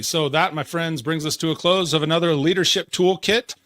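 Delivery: loud, rising announcer delivery; show sign-off. Acted. Host closing the leadership show for listeners, announcer sign-off. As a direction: wrap up the show with a big announcer push.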